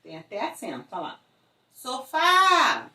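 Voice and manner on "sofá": louder, higher, long FÁ in sofá; sofá with a strongly punched, stretched final syllable; stress on last syllable. Shown as a demonstration. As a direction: call out sofá, holding the FÁ. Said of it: "Se a sílaba tônica for a última".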